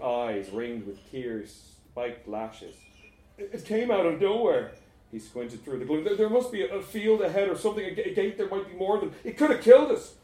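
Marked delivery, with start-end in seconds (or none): 3.4-4.7 high, loud, stammering shock; panicked. Acted. Panicked driver after the crash. 6.0-10.1 high, loud, stumbling over the words; panicked. Acted. Panicked talk after the crash.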